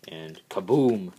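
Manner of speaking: slow, loud kaboom; excited reveal. Real, not acted. Toy transformation reveal.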